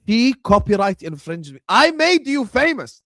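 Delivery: loud, high outburst of outrage; worked-up indignation; indignant rant. Real, not acted. Ranting about copyright claim.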